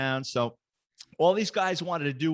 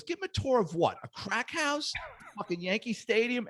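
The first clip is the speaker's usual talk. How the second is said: pissed off; 7 semitones higher, 3 dB quieter than usual. Retold or imitated. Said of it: "And he's pissed".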